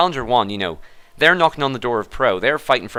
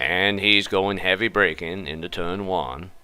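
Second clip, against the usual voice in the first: lightly voiced Morgan Freeman; 4 semitones lower, 5 dB quieter than usual. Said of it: "good impression".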